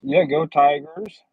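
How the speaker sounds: slow, loud team cheer. Real, not acted. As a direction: call out go Tigers.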